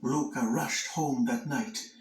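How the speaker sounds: ordinary delivery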